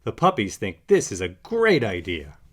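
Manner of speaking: usual speaking voice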